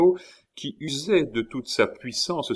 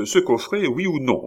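imperious demand; 6 semitones higher than usual. A narrator's reading. Countess demands box.

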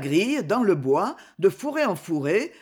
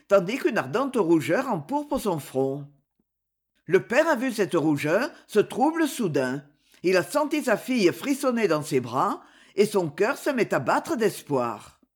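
plain reading voice

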